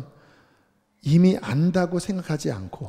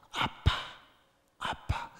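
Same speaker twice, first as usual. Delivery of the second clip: put-on childlike whisper; 9 dB quieter, slower than usual. Shown as a demonstration. Childlike cry to God.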